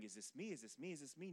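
soft, questioning inner voice; hushed anticipation. Retold or imitated. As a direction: imitate someone wondering under their breath, each question rising. Voices anxious player waiting for pick.

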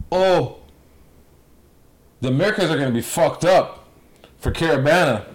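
high-pitched American accent impression. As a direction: put on an over-the-top American accent. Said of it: "that's my American accent".

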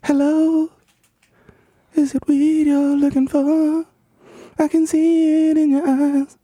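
high, slow, drawn-out character voice; slow high put-on voice. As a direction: stretch every word high and slow. Slow high mock rap voice.

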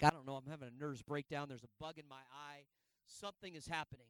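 very quiet, near-inaudible mumbled excuse; faint reenacted breakdown; barely audible excuse. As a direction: drop almost to nothing, as if too upset to talk. Crying, excuses himself to puzzled friends.

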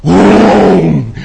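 sudden loud burst; one booming exclamation; explosive boom. Acted. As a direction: punch it out like a blast. Preacher mimics burst of great voice.

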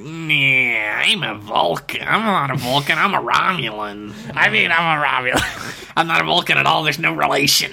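lightly voiced nervous liar, correcting himself; a hint of a flustered alien backpedaling; shifty Romulan on intercom. Host mimicking Romulan over intercom, lightly voiced flustered denial. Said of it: "the guy who talks over the intercom is like".

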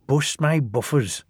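mildly startled exclamation; a touch of amazed dismay; exclaiming. A narrator's reading. Rusty finds the wrecked engine, exclaiming. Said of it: "exclaimed Rusty".

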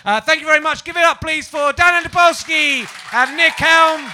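big loud club-host send-off to the crowd; compere sign-off. Acted. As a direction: bring the night home like a compere. Host closing announcement.

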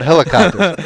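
loud sudden blurt of Helicopters. Retold or imitated. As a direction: blurt out Helicopters without thinking. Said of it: "I just blurted out".

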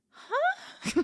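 confused huh. Retold or imitated. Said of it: "I kind of feel like".

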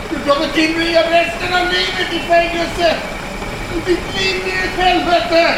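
loud, high, frantic yelling; desperate shout. Acted. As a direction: scream the threat at her. Prisoner yells threats at captor.

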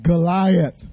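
booming giant's name. Shown as a demonstration. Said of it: "a little bit louder than that".

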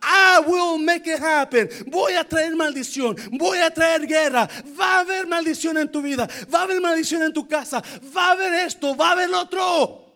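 booming proclamation. Acted. Preacher proclaiming curses on the house. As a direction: thunder it out like God's judgment. Preacher voicing God's judgment.

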